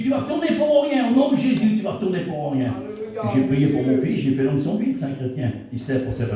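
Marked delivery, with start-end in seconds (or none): 0.0-2.7 faith declaration. Retold or imitated. Father declaring over his son, high and forceful. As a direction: proclaim it over him in Jesus' name.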